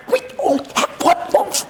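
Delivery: high, breathless whisper of a disciple. Acted. Disciple whispering after Jesus's warning, high whisper. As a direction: whisper it guiltily to the others.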